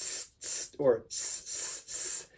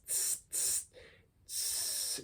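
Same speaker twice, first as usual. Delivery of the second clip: hissed unvoiced tss like a hi-hat; 16 dB quieter, much slower than usual. Shown as a demonstration. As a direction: hiss short tss like a snake. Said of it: "hi-hat sound sounds like tss".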